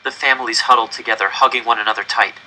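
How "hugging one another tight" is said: ordinary delivery, nothing marked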